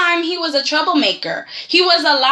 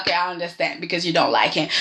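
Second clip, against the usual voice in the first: fast, lower, softer brush-off; quick lower reply as Chloe should have said it; quick hypothetical; 8 semitones lower, 5 dB quieter, much faster than usual. Retold or imitated. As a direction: rattle it off calmly and low. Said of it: "I would have been like".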